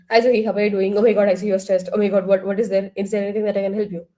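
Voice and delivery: racing through the words, too fast; rushed fast talk. Shown as a demonstration. Counselor showing a client a speech rate that is too fast, rushed. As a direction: gabble it all out in a hurry. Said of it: "the speech rate".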